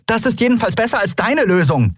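high-pitched scolding; nagging snap. A narrator's reading. Captain turning on the engineer, scolding in high voice. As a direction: cut in with a high, angry retort. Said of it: "keifte Andriel den Skrier an".

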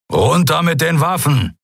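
forceful acted command. A narrator's reading. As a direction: order them to disarm. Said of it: "befahl Keru".

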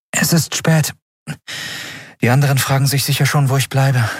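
high character voice, polite farewell; woman taking her leave. A narrator's reading. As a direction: lift the voice, excuse yourself to a friend. Narrator voices Andriel. (hohe Frauenstimme beim Abschied)

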